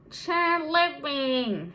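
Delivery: loud, slow, drawn-out catchphrase; Janice from Friends. Acted. Vlogger quoting a sitcom character, loud drawn-out impression. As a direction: stretch the name out loud and slow. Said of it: "Janice from Friends".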